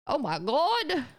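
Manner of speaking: surprised. Real, not acted. Surprised at find in game.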